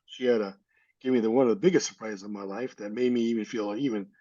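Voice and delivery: ordinary talk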